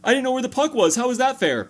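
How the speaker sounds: hammed-up pleading with the referee; whiny goalie. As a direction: complain to the ref like a wronged kid. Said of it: "he looks at the ref like".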